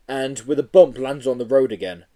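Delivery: plain reading voice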